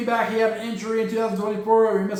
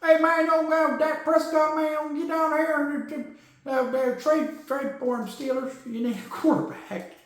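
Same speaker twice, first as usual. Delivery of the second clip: Boomhauer voice; 7 semitones higher than usual. Acted. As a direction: ramble it loose and slurred like a cartoon Texan neighbor. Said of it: "Jerry Jones as Boomhauer".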